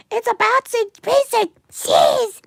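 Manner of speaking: high, loud delighted cry; excited shout. Real, not acted. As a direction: shout with joy at the bouncing cheese. Cheese animation finally bouncing.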